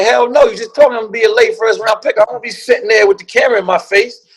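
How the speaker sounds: indignant refusal. Retold or imitated. Player balking at the draft invitation, indignant. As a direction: protest it, pitch lifted throughout.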